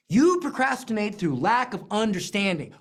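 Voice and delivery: ordinary talk, nothing marked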